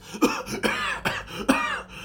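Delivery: put-on high, wheezing old man's cough; old man coughing fit. Portly old man coughs after the punch, wheezing cough. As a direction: hack it out like a frail old man. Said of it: "he does start to cough quite".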